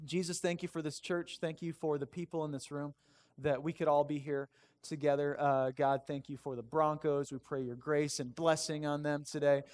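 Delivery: hushed, informal thanks to God; sincere prayer. Real, not acted. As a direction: lead the room gently in prayer. Leads congregation in prayer.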